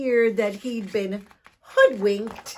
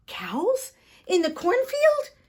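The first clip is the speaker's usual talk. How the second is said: startled; 10 semitones higher, 6 dB louder than usual. Acted. Tom startled by trick.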